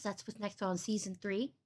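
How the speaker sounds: ordinary talk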